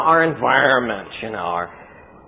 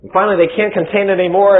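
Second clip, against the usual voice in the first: ordinary talk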